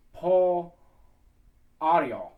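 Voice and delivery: "Paul, Ariel" said loudly in two separated parts; correct pronunciation. Shown as a demonstration. Choir coach showing how it is supposed to be, carefully pronounced. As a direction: demonstrate "Paul", pause, then "Ariel". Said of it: "It's actually supposed to be".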